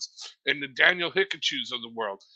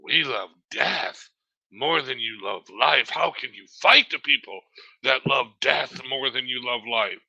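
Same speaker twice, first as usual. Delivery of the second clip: mocking their motto; 6 semitones lower than usual. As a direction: intone it like a grim slogan, lightly mocked. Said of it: "They all say this".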